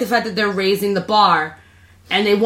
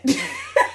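squeaky burst of laughter; high laugh; 4 dB quieter than usual. Real, not acted. Laughing at word use.

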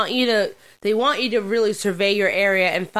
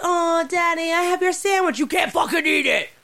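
taunting shout; 10 semitones higher than usual. Pretend. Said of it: "It's like".

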